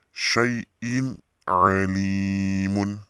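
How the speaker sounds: SYAI'IN ALIMUN very slow, long li; clear recitation. Shown as a demonstration. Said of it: "bacanya jelas ya".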